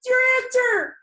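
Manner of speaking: high, loud, emphatic voice of the body; the body answering. Retold or imitated. Listener's body answering her question, high and loud. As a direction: announce it like your body just found the answer. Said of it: "and your body goes".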